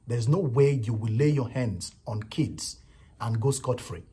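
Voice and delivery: normal talking voice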